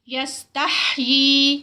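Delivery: یستحیی chanted very slowly with the long vowel held; recited demo. Shown as a demonstration. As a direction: draw out یستحیی in tajweed recitation. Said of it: "یہاں پر بھی اینڈ پہ ٹو counts".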